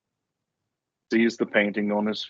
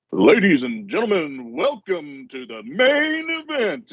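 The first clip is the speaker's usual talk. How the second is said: booming ringmaster; 9 semitones higher than usual. Acted. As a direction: announce it like a circus ringmaster to a dark tent. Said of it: "with a booming voice from the darkness".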